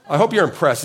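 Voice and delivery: laughing at his own joke; chuckling while bragging. Real, not acted. Joking about his photo.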